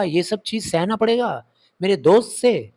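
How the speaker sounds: ordinary delivery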